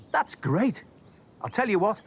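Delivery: plain reading voice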